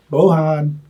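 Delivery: normal talking voice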